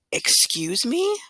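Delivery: drawn-out, high, outraged "excuse me"; indignant principal. Retold or imitated. Principal scolding a lying student, outraged and high. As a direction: stretch it out in shocked disbelief.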